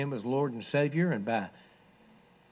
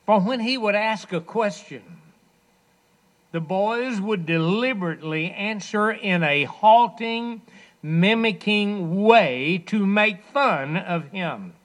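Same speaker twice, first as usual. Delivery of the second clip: loud preaching; 7 semitones higher, 9 dB louder than usual. Acted. Preacher's climax telling of ridicule.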